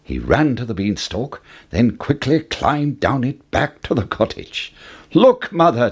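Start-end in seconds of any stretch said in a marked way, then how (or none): 5.1-5.9 put-on higher, louder boyish call; eager storybook exclamation; excited cry. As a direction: cry out to show off a find. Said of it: "Jack cried".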